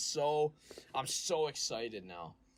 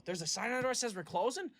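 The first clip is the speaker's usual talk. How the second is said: fast, high-pitched mock disbelief; feigned surprise; 11 semitones higher, faster than usual. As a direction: play innocent, rushing up into a question. Feigns ignorance to prank customers.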